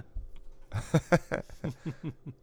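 breathless high wheeze of a laugh; high wheezy laugh. Real, not acted. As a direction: silent high-pitched laughter. Cracking up at own joke.

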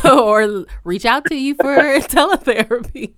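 amused, breaking into laughter midway; laughing. Real, not acted. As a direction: laugh through the words. Joking while asking for contact details.

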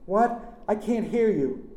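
high, loud strained call; calling back. A narrator's reading. Aunt to nephew over the noise, shouting. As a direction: shout back that you can't hear.

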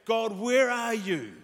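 high, loud, drawn-out plea; distraught prayer. Acted. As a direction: cry out to God as if abandoned. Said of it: "Like the most distraught prayer".

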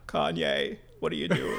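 half-successful politician impression; Bill Clinton. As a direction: attempt an ex-president's timbre without the accent. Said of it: "I can't do the Arkansas accent".

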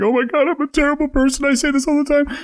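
very high, squeaky retold crying; hammed-up high weepy voice; mock tearful confession. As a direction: imitate yourself wailing with guilt. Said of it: "I actually started crying when this came on".

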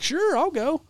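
high-pitched, chirpy imitation of an eager volunteer; game-for-anything eagerness. Retelling a friend agreeing to a last-minute trip, high-pitched eagerness. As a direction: imitate an upbeat friend jumping at it. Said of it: "one of those beam me up Scotty guys".

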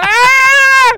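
high, loud comic scream; panicked scream. Retold or imitated. Acting out terrorists panicking.

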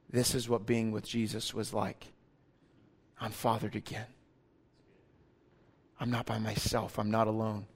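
hushed awe. Retold or imitated. Disciples realising they are fathered again, speaking in hushed awe. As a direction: breathe it like a private confession. Disciples' awed inner words.

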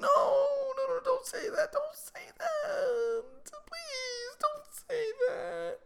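very high, drawn-out mock wail; imitated crying daughter. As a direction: beg tearfully in an exaggerated child's squeal. Voicing daughter begging dad.

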